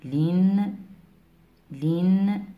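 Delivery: i vowel in "لن", then ring the n; kasra tanween. Shown as a demonstration. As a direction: say "لن" as a rising "linn". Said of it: "اللام منون بالكسر".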